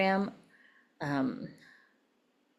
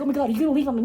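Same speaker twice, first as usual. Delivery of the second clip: a louder, higher mock-panicked husband; imitating panicked husband; 5 semitones higher, 5 dB louder, much faster than usual. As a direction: play the scared husband lightly. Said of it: "He'd be like".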